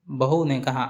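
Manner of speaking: plain reading voice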